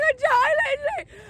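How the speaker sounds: very high, whining mock cry; squeaky sobbing protest, acted; re-enacted tearful meltdown. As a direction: wail helplessly, hammed up.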